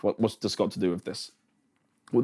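ordinary delivery

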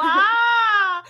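loud, high, drawn-out wow; exaggerated amazement. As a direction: act hugely impressed for a child. Reacts to kid's score.

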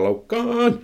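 high, drawn-out mock command; mock screech. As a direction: stretch it into a shrill-pitched squeal, without real volume. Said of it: "mä en rupea kirkumaan".

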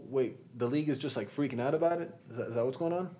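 ordinary talk

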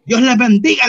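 loud, high, over-eager blessing; hearty church greeting. Retold or imitated. New greeter welcoming the whole church, hearty shout. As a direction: belt out the welcome. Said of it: "le di la bienvenida a todos".

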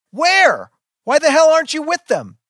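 very high, loud demand; screaming back. A narrator's reading. Child screams back at mother, accusing. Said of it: "She's screaming too".

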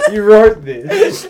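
dragged out between laughs, almost in tears; laughing it again. Real, not acted. Repeats it, cracking up.

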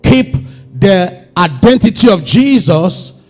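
forceful preaching. Real, not acted. Preacher urges believers not to follow popular opinion, forceful exhortation. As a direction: drive each charge home from the pulpit.

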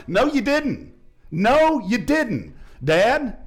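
loud, high and slow rebuke; preaching loud. Real, not acted. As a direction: hammer it home, slowing down.

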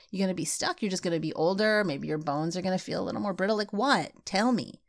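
ordinary talk, nothing marked

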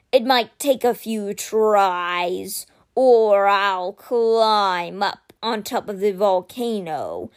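very slow, weighty creature voice; measured and solemn, a stone guardian speaking; slow guardian statue voice. Acted.

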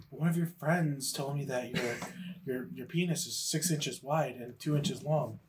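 low, slow, deadpan retelling; hint of gossiping girl. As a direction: report it slowly and softly, lightly acted. Retold girl reporting the rumour.

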